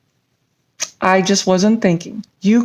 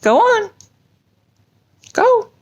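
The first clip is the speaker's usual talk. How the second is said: a lightly voiced high puppet, eager and pushy; chirpy puppet eager for the story; 10 semitones higher than usual. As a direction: nudge your friend to get on with it. Puppet voice urging reading.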